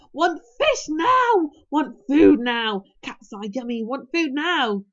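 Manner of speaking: loud, slow, insistent demands; shouting dragon. A narrator's reading. Toothless struggling wildly in Hiccup's grip, shouting.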